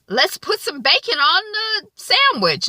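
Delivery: very high, casual cheer; light kitchen suggestion; cheery Lawrence. Retold or imitated. Boyfriend casually suggests bacon at lunch, mock cheery.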